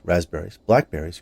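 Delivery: ordinary delivery, nothing marked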